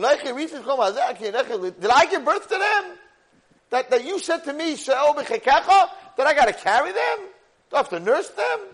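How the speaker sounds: high, loud exasperated questions; indignant protest. Retold or imitated. Moshe protests carrying the people, indignant shouting. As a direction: fire each question upward, outraged.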